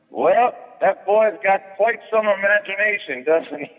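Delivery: high, slow, sarcastic heckle; mocking heckler. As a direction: needle the comic with slow sarcasm. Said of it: "he heckles him by going".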